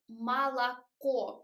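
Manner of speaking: slow "молоко" with wide, full A's, mah-lah-KO; over-open A. Shown as a demonstration. Imitating other teachers' explanation of "молоко", wide A vowels. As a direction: spell out "молоко" with two clear open A sounds. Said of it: "other teachers explain their pronunciation like".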